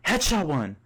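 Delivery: high, loud triumphant yell; scream. Acted. Gamer calls out his headshot to the boys, loud high yell. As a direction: shout the kill call. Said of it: "You then scream".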